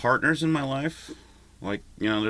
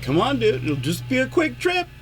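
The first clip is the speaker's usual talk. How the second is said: coaxing doctor voice, pitched much higher and louder; mock doctor; 14 semitones higher, 5 dB louder than usual. Doctor coaxing him into the ambulance, hammed-up high voice. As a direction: play the doctor sweet-talking him into the ambulance. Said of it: "be like".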